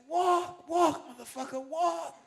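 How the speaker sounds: loud high yell; shouting over the band. Retold or imitated. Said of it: "I just screamed over Tony".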